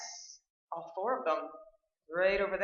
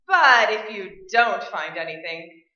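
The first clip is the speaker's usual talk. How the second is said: emphatic; 11 dB louder than usual. Acted. Agent telling the host her hope, loud and emphatic. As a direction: push the point loudly.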